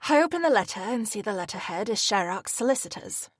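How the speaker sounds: ordinary delivery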